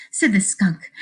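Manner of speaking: ordinary talk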